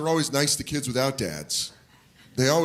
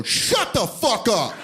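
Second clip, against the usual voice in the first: put-on higher, slow taunting kid voice; brash kid; 8 semitones higher, much slower than usual. Rude kid snapping at the nerd, high drawn-out taunt.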